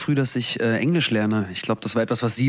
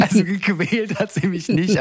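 laughing; 8 semitones higher than usual. Real, not acted. Laughing at the question.

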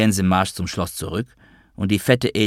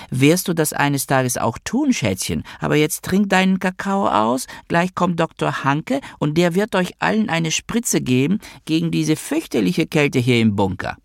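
much higher put-on woman's voice; Magda, mother voice; 9 semitones higher than usual. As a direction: soothe a child in a high, singsong motherly register. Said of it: "das war Noah wieder als Magda". (hohe, gespielte Mutterstimme, beschwichtigend)

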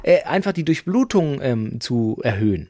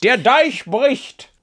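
high, loud, very slow dramatic proclamation; mock epic announcement; 9 semitones higher, 4 dB louder, much slower than usual. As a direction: proclaim it like a catastrophe. Dramatic flood image joke. (übertrieben dramatisch, hoch, laut, langsam)

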